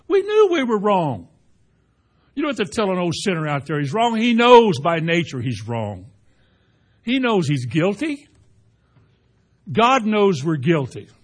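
loud rising preacher's cry; preaching at full force. Acted. Preacher pressing guilt on the congregation, loud and driving. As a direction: drive it home to the congregation.

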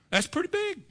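a touch of mock awe, high pitched; awed. Real, not acted. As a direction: gasp it out in wonder, high. Marvels at giant's height.

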